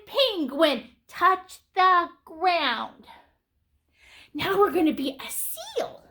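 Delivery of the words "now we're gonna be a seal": ordinary talk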